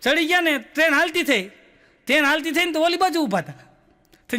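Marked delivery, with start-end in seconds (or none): none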